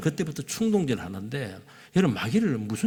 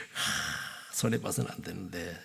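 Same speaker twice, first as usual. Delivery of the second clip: grumbling inner voice; 6 semitones lower, 11 dB quieter, slower than usual. Retold or imitated. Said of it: "이러면서 올라오는 겁니다".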